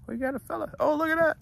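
high, excited delight, ending in a laugh; delighted squeal. Real, not acted. Man delighted as a llama comes close, high squeal. Animal nuzzling him.

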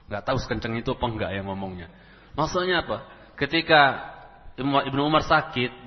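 ordinary talk, nothing marked

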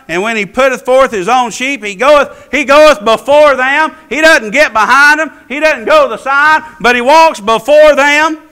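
building to a loud, high sermon chant; fervent, rhythmic preacher delivery; rising preaching cadence. Real, not acted. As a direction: preach it with mounting force. Preaches at full force.

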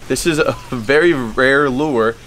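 usual speaking voice

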